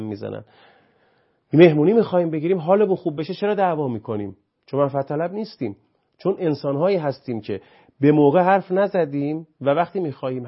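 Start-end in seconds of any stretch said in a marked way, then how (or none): 1.5-10.5 higher, louder, fast heated preaching; impassioned lecture. Real, not acted. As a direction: drive the point home with rising passion.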